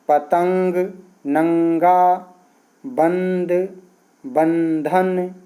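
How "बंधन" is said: बंधन stretched very slowly, nasal न before ध; teacher draws out बंधन for students; slow nasal demo. Shown as a demonstration. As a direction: spell out बंधन slowly through the nose. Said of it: "जब नाक से उच्चारण हो".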